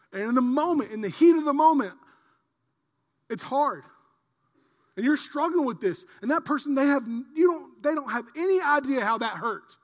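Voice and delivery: loud, high, impassioned sermon build; full-force preaching. Acted. Pastor urging the church about hurt, loud preaching. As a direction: preach it hard to the crowd.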